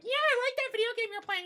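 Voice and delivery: mock a desperate woman fawning for attention; mocking girly voice. Mocking desperate women's chatter.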